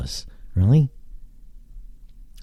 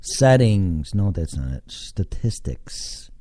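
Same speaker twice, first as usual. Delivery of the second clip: ordinary talk, nothing marked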